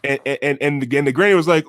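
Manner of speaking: normal talking voice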